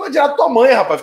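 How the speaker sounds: high, loud insult shot back; retold angry retort. Snapping at the fan who praised the goal, angry. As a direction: fire it back at him.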